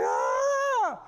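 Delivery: very high, shouted birthing scream; mock scream. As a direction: let out the labor scream. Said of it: "she's like".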